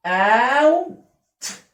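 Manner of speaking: اوت drawn out very slowly, rising; stretched ow sound. Shown as a demonstration. Teacher stretching اوت for the class. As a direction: stretch the اوت vowel long. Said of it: "كلمة ايه؟".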